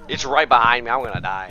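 panicked loud yelling; being chased. Real, not acted. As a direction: yell it as the ghost closes in. Ghost hunting right behind.

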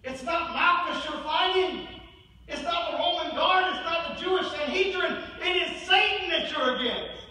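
high, forceful sermon delivery; impassioned preaching. Acted. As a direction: preach it urgently, hammering each point.

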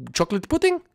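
mocking lilt, pitch shot up; high sarcastic jab; sarcastic high retort. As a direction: sneer the question up high.

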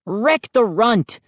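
high, slow battle cry; excited cry. A narrator's reading. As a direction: draw it out like a rallying call. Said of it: "I cried".

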